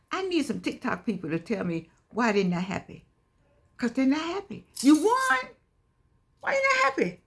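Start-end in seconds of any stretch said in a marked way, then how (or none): 4.8-7.2 high, disbelieving squeal; high incredulous challenge. Acted. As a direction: pitch it way up in disbelief. Incredulous question to winners.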